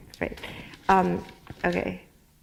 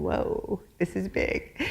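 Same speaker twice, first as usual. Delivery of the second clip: breathy hushed aside, imitating the doctors; half-whispered, as if not meant to be overheard; soft hushed imitation of surgeons; hushed retold whisper; 2 semitones lower, 8 dB quieter, much slower than usual. Doctors wheeling her off, quoted.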